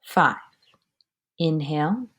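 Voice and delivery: ordinary talk, nothing marked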